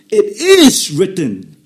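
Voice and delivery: slow and loud, IS stressed; loud proclamation. Acted. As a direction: declare it like a verdict. Preacher proclaiming the key phrase.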